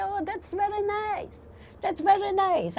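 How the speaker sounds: high, airy "that's very nice" in disbelief; stunned disbelief. Retold or imitated. Survivor telling friends news of a living relative, in disbelief. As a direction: mimic his dazed reply to the news.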